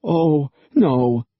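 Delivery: high frightened whimper; a touch of terrified whimper. A narrator's reading. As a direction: whimper it in dread. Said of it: "he found himself whimpering".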